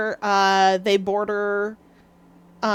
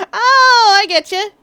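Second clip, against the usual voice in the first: loud, high cry of realization; excited realization; 10 semitones higher, 9 dB louder than usual. Real, not acted. Streamer suddenly getting what chat meant, excited realization. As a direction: let the "oh" burst out high and loud.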